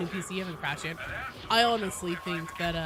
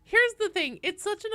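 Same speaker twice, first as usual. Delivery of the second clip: excited; 13 semitones higher, 7 dB louder than usual. Real, not acted. Laughing about lost joke name.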